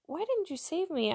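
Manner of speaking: high, aggrieved plea; plaintive complaint. Acted. Dead believer to God in heaven, plaintive. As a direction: protest being let down.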